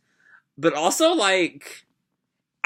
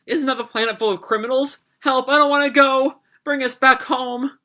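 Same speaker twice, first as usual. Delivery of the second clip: high, rising panic, pleading; terrified captive begging to go home; panicked begging; 11 semitones higher than usual. Acted. Captive girls beg in panic.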